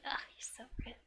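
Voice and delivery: soft, whispery reproach; mock offended. Tutor translating the girl's complaint of rudeness, whispery mock offence. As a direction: murmur it as a mild affronted aside. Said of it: "She's like".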